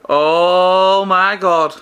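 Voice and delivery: drawn-out oh my god. Real, not acted. Tasting his baked treats.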